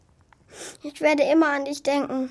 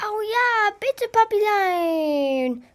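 high drawn-out begging; pleading child; 7 semitones higher, 4 dB louder, much slower than usual. Acted. Pinocchio begging his father to let the fairy stay. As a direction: stretch it out, wheedling sweetly.

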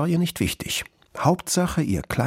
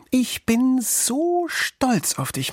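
high, very slow, emotional praise; moved, drawn-out motherly pride; proud mother; much slower than usual. A narrator's reading. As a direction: gush lingeringly over your child. Mother fox praising her son.

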